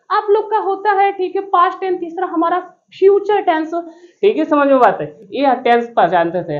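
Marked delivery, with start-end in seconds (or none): 0.1-3.7 high-pitched put-on schoolmistress voice; mimicked madam teacher. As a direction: imitate a kindergarten madam, pitched way up. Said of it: "आप madam जी बोलते थे".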